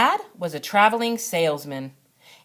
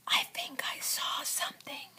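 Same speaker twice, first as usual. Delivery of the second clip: scared breathless whisper; barely a breath, afraid of being heard; 11 dB quieter than usual. A narrator's reading. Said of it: "Billy whispered".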